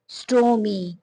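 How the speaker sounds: स्टोमी with a drawn-out ee at the end; final ee sound. Shown as a demonstration. Teacher showing learners the Y sound, स्टोमी ending in long ee. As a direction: stretch the last vowel of स्टोमी into ee. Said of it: "आवाज ई की है".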